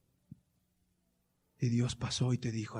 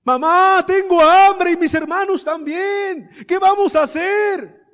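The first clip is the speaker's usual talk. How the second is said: loud, high, slow wail to mother; imitated desperate child; 18 dB louder, slower than usual. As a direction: cry out as a hungry girl pleading for help. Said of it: "y la ve llorando".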